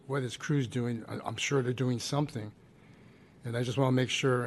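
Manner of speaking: ordinary talk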